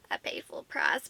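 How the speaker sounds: low guilty whisper. Real, not acted. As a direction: drop to a low secret whisper. Confessing paying full price.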